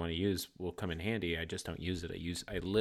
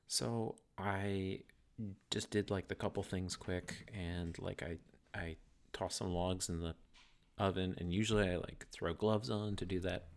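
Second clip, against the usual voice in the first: ordinary delivery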